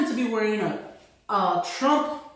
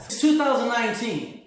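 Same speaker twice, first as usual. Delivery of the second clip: normal talking voice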